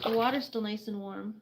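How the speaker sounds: normal talking voice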